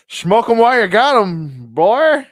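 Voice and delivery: high, loud, swooping put-on voice; goofy catchphrase voice. Joking about the steed called Cannabis, goofy catchphrase voice. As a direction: whoop it out as a joke. Jokey stoner catchphrase after laughing.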